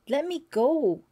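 high, loud cry to be let go; a frightened girl pleading loudly to be released. A narrator's reading. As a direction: cry out in fear, twisting free. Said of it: "she cried".

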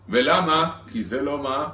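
ordinary delivery

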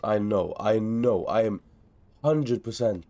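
ordinary delivery

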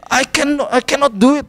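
high-pitched frustrated whine of giving up; frustrated son. Retold or imitated. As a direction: imitate a child giving up, pitched well up. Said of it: "orangnya gampang frustasi". (menirukan anak yang frustrasi, nada tinggi)

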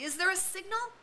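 high, urgent worried asking; anxious question. A narrator's reading. Piggy asking Ralph about the ship, anxious and high. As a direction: plead for an answer. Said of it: "said Piggy anxiously".

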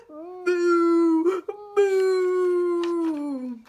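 loud, high drawn-out moos; long held cow calls, hammed up; mooing cow. Confused cow woken in the barn, long moos. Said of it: "who muttered".

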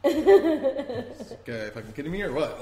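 slow, high, laughing under the breath; can't believe this guy, half laughing; incredulous chuckle; laughing disbelief. Retold or imitated. Inner thought, laughing disbelief.

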